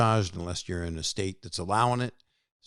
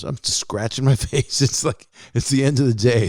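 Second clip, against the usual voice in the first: higher-pitched, amused and laughing through it; 5 semitones higher, 5 dB louder than usual. Real, not acted. Podcaster confessing to scratching his face, laughing. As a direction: talk through a burst of giggles.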